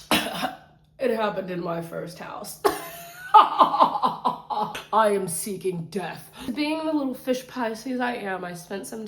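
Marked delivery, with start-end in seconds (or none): 2.7-3.3 a tiny voiceless giggle pitched very high; very high airy giggle. Real, not acted. Before a dark joke to viewers, a very high voiceless giggle. 3.3-6.3 very slow, louder, laughing through each word. Real, not acted. Joking darkly to viewers, slow and laughing.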